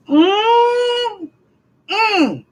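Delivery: high, tearful whimper through closed lips; whimpering hum. Real, not acted. Upset over the letter, high tearful whimper. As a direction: let a choked high whimper escape. Overcome while ranting.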